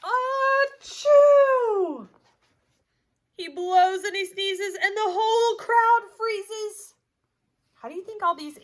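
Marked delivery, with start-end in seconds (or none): 0.0-2.1 high, loud drawn-out sneeze; huge sneeze. Acted. Bear sneezes in the story. 3.4-6.9 lively storybook voice, big swings of pitch and loudness; animated read-aloud; reading to children. A narrator's reading. Teacher reads picture book aloud.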